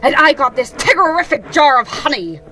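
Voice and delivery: Tigger, boasting. Acted. Bouncing friend showing off his honey jar, loud and proud. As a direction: punch it out proudly, high and loud.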